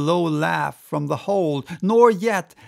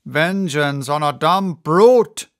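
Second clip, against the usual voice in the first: loud protest, swelling at the end; indignant cry; 7 dB louder than usual. A narrator's reading. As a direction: cry out against the captain. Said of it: "cried Starbuck".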